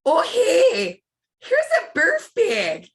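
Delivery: high, far-off put-on mom voice; imitating mother. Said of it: "all I did was talk like our mother".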